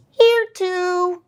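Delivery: put-on high, small child's voice; student says here; kid answering roll. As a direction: pipe up like a little kid.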